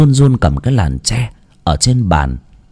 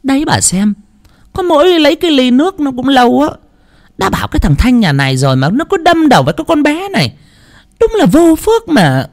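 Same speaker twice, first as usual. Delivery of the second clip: high, fast, carping complaint; faster than usual. Acted. Mother-in-law complaining to the guest, high and carping. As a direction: needle her with every word. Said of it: "miệng vẫn chỉ chiết".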